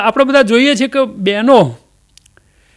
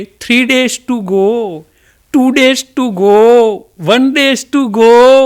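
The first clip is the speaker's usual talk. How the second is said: slow, drawn-out sing-song countdown; mock countdown; much slower than usual. Comedian mocks couples' pre-wedding countdown posts, slow and sing-song. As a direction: drag out each day teasingly.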